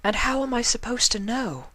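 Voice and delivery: flustered high mare voice; stammering Octavia. Acted.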